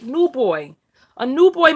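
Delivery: ordinary talk, nothing marked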